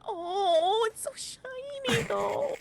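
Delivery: high, drawn-out cooing wonder; hammed-up, high-pitched awe; awed character. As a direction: coo over a shiny treasure. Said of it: "And she's kinda like".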